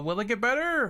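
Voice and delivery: ordinary talk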